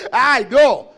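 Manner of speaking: hammed-up whiny lament; mock a faithless complainer; mock complaining. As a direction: imitate someone moaning in self-pity. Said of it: "no quejándose".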